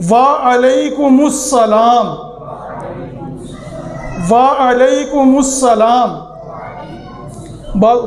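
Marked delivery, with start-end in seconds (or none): 0.1-6.1 slow "وعلیکم السلام" with a full wa-alaikumu; careful pronunciation. Shown as a demonstration. Teacher leading viewers through the reply, slowly. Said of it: "آپ بھی پڑھ لیں گے".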